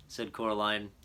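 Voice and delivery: plain reading voice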